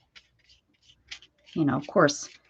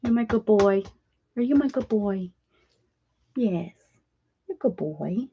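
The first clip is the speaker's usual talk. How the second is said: pet talk; 4 semitones higher than usual. Acted. Cooing at the dog.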